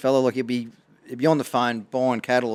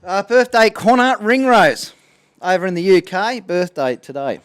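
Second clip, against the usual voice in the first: higher and louder, slow drawn-out announcement of the name; birthday shout out; 7 semitones higher, 7 dB louder, slower than usual. Real, not acted. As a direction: call the name out like a radio shout out.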